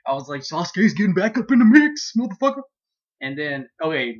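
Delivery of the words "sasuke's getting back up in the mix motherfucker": loud, high, rising excitement; hyped-up fan cheer. Retold or imitated. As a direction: crow it louder and higher to the end. Re-enacts own hyped fan reaction.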